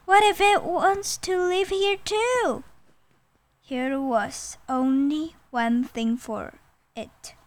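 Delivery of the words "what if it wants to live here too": high, anxious tiger voice; acted fretting, pitched high; trembling tiger. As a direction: worry aloud as a timid storybook tiger. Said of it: "Tiger tembled".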